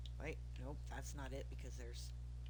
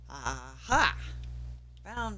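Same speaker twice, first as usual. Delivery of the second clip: loud triumphant exclamation; proud aha, loud; 14 dB louder, much slower than usual. Real, not acted. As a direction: shout the discovery. Found the stairs.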